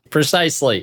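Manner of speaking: high, laughing agreement. Real, not acted. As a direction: say it through a laugh.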